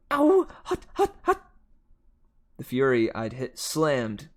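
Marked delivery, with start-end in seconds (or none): 0.1-1.5 very high yelps of pain; pained yell. A narrator's reading. Grover holding the burning whip, pained high yelping. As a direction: squeal as if your hands burn. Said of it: "he yelled".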